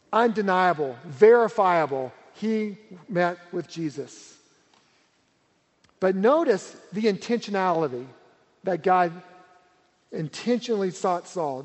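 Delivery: ordinary talk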